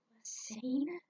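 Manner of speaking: whispering the name in wonder; awed whisper. Acted. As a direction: barely breathe the name.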